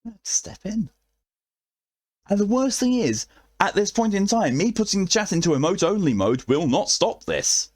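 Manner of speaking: squeaky flustered high pitch; high panicked voice. Real, not acted. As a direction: fret in a high voice. Chat riot, mock panic.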